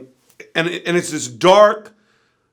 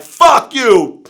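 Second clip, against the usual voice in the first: high, loud burst of abuse; angry yell; 7 semitones higher, 8 dB louder than usual. Retold or imitated. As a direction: yell it furiously at a trapped rival. Bill spitting at brother in car.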